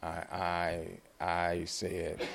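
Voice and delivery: low, slow stammer; hushed, halting, lost for words; stunned. Retold or imitated. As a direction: falter softly on the first word. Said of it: "And I was stunned".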